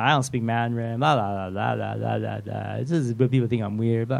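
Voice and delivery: low, monotone blah-blah run; droning excuses. Retold or imitated. Pastor mimicking his own excuses in his head, low drone.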